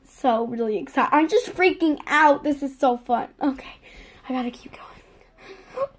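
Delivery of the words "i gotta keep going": breathless whisper, voice almost gone; overwhelmed whisper. Real, not acted. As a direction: whisper it as if too moved to speak. Overwhelmed whisper over the plush.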